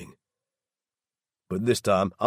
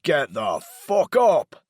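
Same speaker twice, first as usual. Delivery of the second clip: louder and higher, slow furious yell; 8 semitones higher, 7 dB louder, much slower than usual. A narrator's reading. Laz yells at Daryl to get out of bed, furious yell. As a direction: bellow it down at him. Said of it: "I yell at him".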